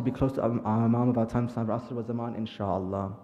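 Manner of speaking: low, soft supplication; quiet dua with repeated Ya Allah; closing prayer. Acted. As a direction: pray over the gathering. Closing dua after the majlis.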